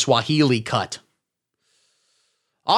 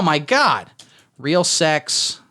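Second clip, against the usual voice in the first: ordinary talk, nothing marked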